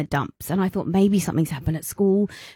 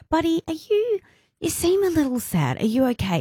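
gentle asking; 9 semitones higher than usual. Retold or imitated. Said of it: "I was like".

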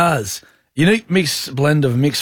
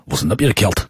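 low Northern Irish voice; a put-on Northern Irish accent; 7 semitones lower than usual. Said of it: "that was Northern Irish".

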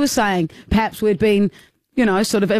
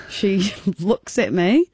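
ordinary talk, nothing marked

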